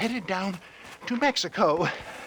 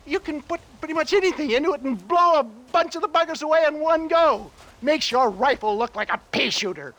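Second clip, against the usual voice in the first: high, fast, eager boasting; excited sales pitch; 7 semitones higher than usual. Acted. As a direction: sell it like a showman. Salesman enthusiasm about the gun.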